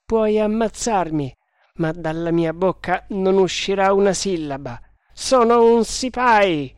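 high, loud defiant declaration. Acted. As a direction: defy the threat proudly. Defiant soldier facing a gun.

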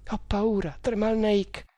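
put-on high-pitched girl's plea; frightened. A narrator's reading. Girl to her protector Tremal Naik, mildly frightened.

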